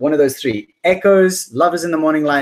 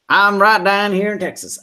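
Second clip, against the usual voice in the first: put-on southern accent; 7 semitones higher, 3 dB louder than usual. Greeting a Texan viewer in a southern accent. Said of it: "I just love the southern accent".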